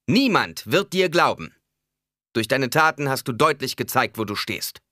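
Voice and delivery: high, loud, fast denunciation; public accusation. A narrator's reading. Jessica denounces Alia before everyone, loud and fierce. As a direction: proclaim it to the whole room.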